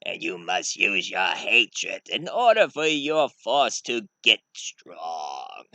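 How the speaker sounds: slow, drawn-out villain voice; ominous, measured menace; sinister Sith master. Acted. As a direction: draw it out like a dark lord grooming a pupil. Sith master Sidious recruits an apprentice.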